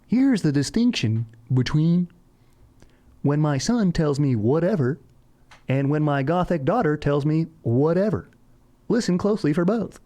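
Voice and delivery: high, hammed-up nature-show explainer; mock narrator voice. Host spoofing the bird guy explaining son and gothic daughter, high mock narrator. Parodying the website narrator's distinction script.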